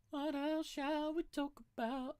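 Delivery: very high, slow, squeaky joke voice; silly squeaky voice. Pretend. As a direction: pitch it way up like a cartoon.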